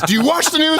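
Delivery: high-pitched mock indignation; going nuts. Chicago guy repeating his challenge on Trump, going nuts. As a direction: imitate the incensed arguer's challenge. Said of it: "he starts going nuts".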